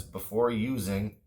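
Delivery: normal talking voice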